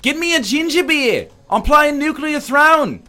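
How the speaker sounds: high-pitched, hammed-up New Zealand accent. Shown as a demonstration. Michael Caine impression slipping, high exaggerated New Zealand accent. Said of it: "gets back into the New Zealand accent".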